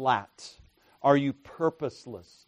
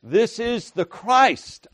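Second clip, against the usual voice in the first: higher and louder, slow and awed; others' exclamation; 8 semitones higher, 6 dB louder, slower than usual. Retold or imitated. Preacher voicing others in the crowd, awed and loud. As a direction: proclaim it in astonishment. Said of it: "others say".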